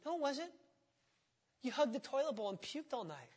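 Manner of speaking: usual speaking voice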